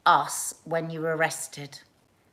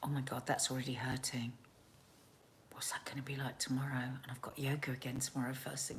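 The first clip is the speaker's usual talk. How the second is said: hushed aside about the ache; rapid whispered grumble to herself; 6 semitones lower, 4 dB quieter, faster than usual. Real, not acted. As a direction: breathe it out under your breath. Sore after exercise, talking to herself.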